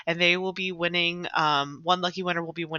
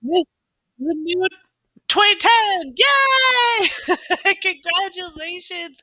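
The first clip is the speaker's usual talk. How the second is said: high, loud winner cheer; 12 semitones higher, 9 dB louder, slower than usual. Pretend. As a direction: scream the winner with joy.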